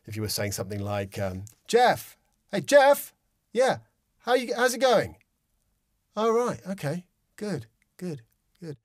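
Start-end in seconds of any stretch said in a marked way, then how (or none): 1.7-8.8 high, casual neighbour greeting from arm's length; calling over the garden fence. Shown as a demonstration. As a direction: call out to a neighbour across the fence. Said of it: "a man has to speak to another man over the garden fence".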